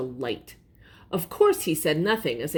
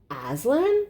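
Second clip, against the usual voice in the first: high, thrilled question; a shiver of excitement at the name; excited shiver; 6 semitones higher than usual. A narrator's reading. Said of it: "cried Lucy with a shiver".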